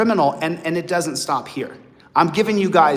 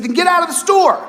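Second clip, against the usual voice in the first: higher, louder mock clerk; store clerk telling off; 10 semitones higher, 5 dB louder than usual. Clerk throwing the customer out, mock loud retort. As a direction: snap back as the annoyed clerk. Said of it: "And he said".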